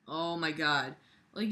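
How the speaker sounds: usual speaking voice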